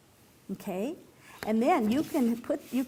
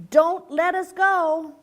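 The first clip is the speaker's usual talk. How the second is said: slow, louder plea; pleading call; 6 semitones higher, 6 dB louder, slower than usual. A narrator's reading. Father frog begging the hawk, slow and loud. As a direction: beg the hawk slowly and loudly. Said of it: "So now he's telling the hawk".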